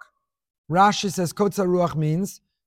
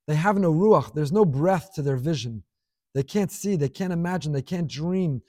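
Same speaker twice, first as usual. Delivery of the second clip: usual speaking voice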